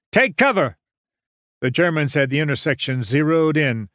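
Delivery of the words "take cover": high, urgent yelled order. A narrator's reading. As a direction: yell the warning to everyone. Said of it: "Winters yelled".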